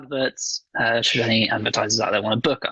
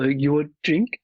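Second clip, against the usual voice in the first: mock butler voice; 4 semitones higher than usual. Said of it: "having Reginald go".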